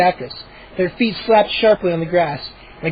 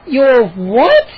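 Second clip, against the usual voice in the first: surprised cry; 5 semitones higher, 5 dB louder than usual. Acted. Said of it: "Mindy cried".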